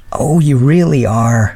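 high, drawn-out mild exasperation; exasperated exclamation. A narrator's reading. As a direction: exclaim in fond, helpless exasperation. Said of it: "she exclaimed".

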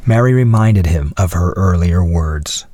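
plain reading voice

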